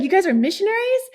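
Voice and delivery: high, quick, thrilled disbelief; amazed question. Retold or imitated. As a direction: imitate someone who can't believe the good news. Acts seller's delighted astonishment.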